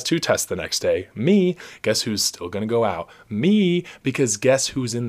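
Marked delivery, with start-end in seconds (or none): 1.1-1.6 high, bright "Me!"; excited. Real, not acted. Answering own question. 3.3-3.8 high, bright "Me!"; excited. Real, not acted. Answering own question.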